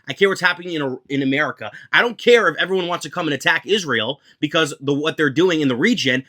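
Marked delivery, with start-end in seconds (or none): none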